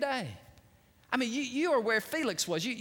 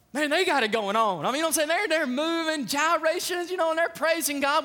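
higher-pitched, excited preacher's momentum; fired-up preaching; 8 semitones higher, 5 dB louder than usual. Real, not acted. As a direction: build it up for the congregation. Preaches at full force.

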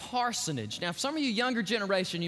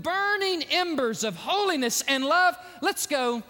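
high, raised, rolling sermon voice; full-force pulpit delivery; impassioned preaching; 7 semitones higher, 4 dB louder than usual. Real, not acted. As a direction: build into a fervent plea to the congregation.

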